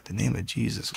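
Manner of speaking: whispered, doubtful question; hushed disbelief. Retold or imitated. New believer questions the pastor's advice, hushed disbelief. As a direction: echo it back under your breath, puzzled.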